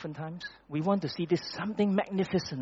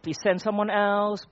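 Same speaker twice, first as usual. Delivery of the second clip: mild complaining plea; 7 semitones higher, 3 dB louder than usual. Retold or imitated. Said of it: "Moses complained".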